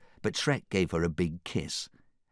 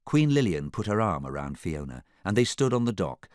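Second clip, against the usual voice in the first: ordinary delivery, nothing marked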